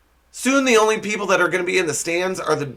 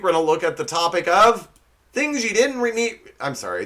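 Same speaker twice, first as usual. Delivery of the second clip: ordinary delivery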